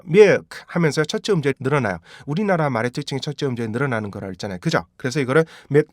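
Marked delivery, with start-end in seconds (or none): 0.1-0.6 밀크 with the first syllable stretched out; loosened first syllable. Shown as a demonstration. Teacher shows the lengthened first syllable, wrong pronunciation. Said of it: "이게 풀리면요". 5.7-5.9 밀크 in separate short beats, myet, uh, k; split-up milk. Shown as a demonstration. Teacher breaks milk into parts for learners, segmented pronunciation. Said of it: "이게 안 끊기게 하셔야".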